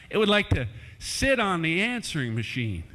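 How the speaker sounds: ordinary talk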